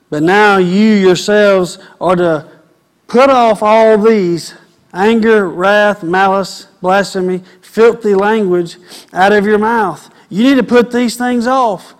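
loud, high preaching, hammering each point; full-force sermon voice; fiery preaching. Acted. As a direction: preach it hard at the men.

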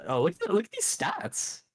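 high, amazed exclaim; excited. Real, not acted. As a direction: wow at the stats. Amazed at the clip's stats.

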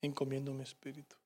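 faint, fading breath of a dying man; dying last words, almost gone; dying whisper. Acted. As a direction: let the words barely leave you, soft and far. Jesus's last words, voiced.

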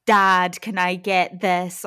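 loud, emphatic "Dad" for bystanders to hear; really clear, pointed. Retold or imitated. Imitating friend addressing dad before onlookers, pointedly clear. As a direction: make the relationship obvious, loudly and clearly. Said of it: "really clearly be like".

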